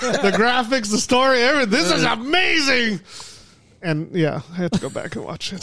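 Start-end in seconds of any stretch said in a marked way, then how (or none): 0.0-3.0 excited. Retold or imitated. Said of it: "and I was like".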